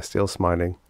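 ordinary delivery, nothing marked

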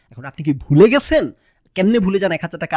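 loud, disbelieving question, stretched at first; incredulous challenge. Retold or imitated. Customer confronts a forgetful man by phone, loudly incredulous.

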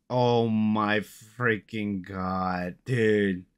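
slow, drawn-out disbelief; real, stunned dismay, dragged out; dismayed groan of words. Real, not acted. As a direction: stretch each word out in dismay. Just closed the game by accident.

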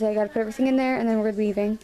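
ordinary delivery

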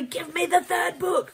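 whiny high "give me the third book"; mock pleading. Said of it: "I was like".

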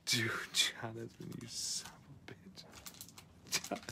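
soft whispered insult; whispered curse. Real, not acted. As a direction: whisper it in disbelief. Curses at a viewer's pick.

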